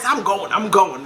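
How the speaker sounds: normal talking voice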